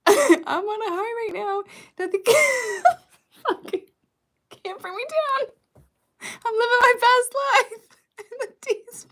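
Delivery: high, loud, laughing impression; giggling high-pitched mimicry. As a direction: squeal it through giggles, stretched out.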